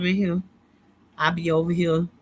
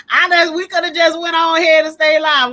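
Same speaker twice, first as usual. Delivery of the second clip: louder, higher, laughing hard through the line; breaking up at her own story; 10 semitones higher, 10 dB louder than usual. Real, not acted.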